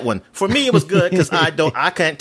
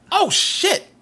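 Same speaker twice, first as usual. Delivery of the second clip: mock-startled "Oh, shit" pitched way up; retold surprise. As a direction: replay the moment it clicked, voice jumping high. Retold shock at realizing.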